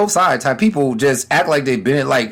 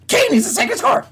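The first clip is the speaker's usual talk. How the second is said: mocking pundit. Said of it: "So it's always like this".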